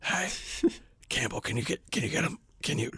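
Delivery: strained hoarse whisper, voice gone; voiceless whisper. Retold or imitated. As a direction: whisper it like your voice is shot. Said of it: "I could only whisper".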